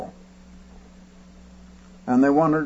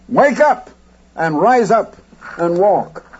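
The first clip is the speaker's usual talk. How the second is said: commanding call; 5 semitones higher than usual. Retold or imitated. Commanding the unconscious woman to rise.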